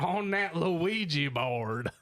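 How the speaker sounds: slow, drawn-out mock hillbilly; hillbilly accent. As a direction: stretch it out like a country bumpkin. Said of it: "Fucking hillbilly seance over here".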